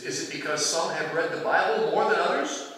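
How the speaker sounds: normal talking voice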